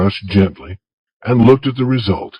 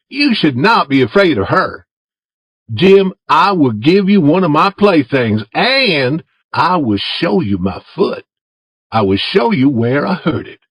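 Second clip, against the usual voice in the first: put-on higher, boyish voice for Tom; Tom asking Jim; 6 semitones higher than usual. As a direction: coax Jim in a raised boy's voice.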